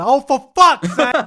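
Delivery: loud, high frustrated groan; exasperated outburst. Real, not acted. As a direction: blurt it out in disgust.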